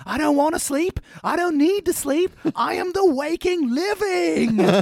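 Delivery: high, manic sleep-deprived rant; hammed-up unhinged cartoon voice; frantic Rocko. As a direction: rave like someone who won't ever sleep.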